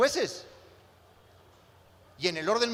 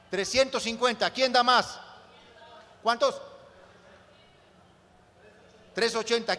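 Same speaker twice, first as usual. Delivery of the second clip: higher, louder auctioneer building up; auctioneer calling bids; 4 semitones higher, 4 dB louder than usual. Pretend. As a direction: drive the bids up, louder each call. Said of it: "Voy a hacer una especie de subasta".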